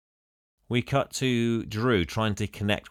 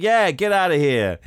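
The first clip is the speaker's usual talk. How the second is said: high, loud retold dismissal; mock shout; 8 semitones higher, 7 dB louder than usual. Christine backing up the photographer, mock shout. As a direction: pipe up loudly, imitating her.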